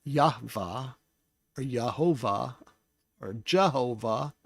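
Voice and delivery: Yahweh as yah-VAH, then Yehovah, Jehovah, each set apart; rejected pronunciations. Shown as a demonstration. Teacher listing what the name is not, Yahweh pronounced yah-VAH. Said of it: "His name is not".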